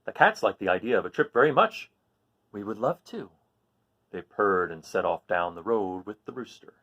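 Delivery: lively read-aloud storytelling; story narration. A narrator's reading. Teacher reading a story to the class, lively narration. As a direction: read it like a picture book to kids. Reads a picture book aloud.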